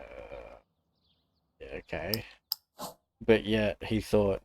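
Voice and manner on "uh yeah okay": meek nervous reply. Retold or imitated. Reply to knife threat.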